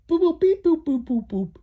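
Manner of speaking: high, loud droid beeps; R2 chirping excitedly at the cars; robot beeping. Acted. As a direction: boop like a little astromech. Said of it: "R two D two speaks up".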